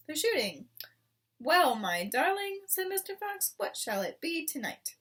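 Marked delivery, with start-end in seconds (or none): 1.4-4.9 higher, affectionate fox voice; fond Mister Fox. A narrator's reading. Mister Fox asking his wife about dinner, fond. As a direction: charm your wife.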